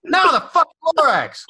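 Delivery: very high, loud insistent squeal; drunk friend. Retold or imitated. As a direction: shriek it like a drunk buddy. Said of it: "And he goes".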